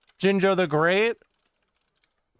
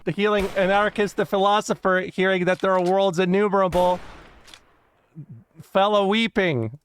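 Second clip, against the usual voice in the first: dramatic quote reading; 4 dB louder than usual. Acted. Streamer reads an Alexander the Great anecdote to chat, solemn storytelling. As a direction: read it like a grand historical tale.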